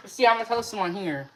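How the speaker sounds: normal talking voice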